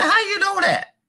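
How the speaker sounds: squeaky high astonished question; amazed old lady. Retold or imitated. Old lady asking the narrator how he guessed, high and astonished.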